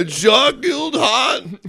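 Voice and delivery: high, loud, slow mock outrage; hammed-up strained movie-star yell; shouted Stallone-like complaint. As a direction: holler it like an aggrieved action star. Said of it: "Why does it sound like Stallone?".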